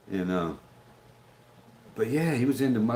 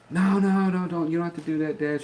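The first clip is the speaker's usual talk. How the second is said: lightly voiced daughter, higher, pleading no; a hint of a pleading young woman; imitating his daughter; 7 semitones higher than usual. Dad retelling his daughter begging him not to come, lightly imitated.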